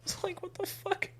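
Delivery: high, soft disbelieving squeak; baffled, voice jumps up; disbelief. Real, not acted. Reacting to absurd scene.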